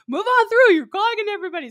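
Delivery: high, loud mock marshal shout; imitated yell. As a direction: shout them along the chute. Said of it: "yelling at us".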